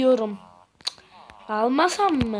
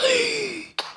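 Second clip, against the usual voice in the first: gasp; 10 semitones higher than usual. Real, not acted. Sudden fright at nightfall.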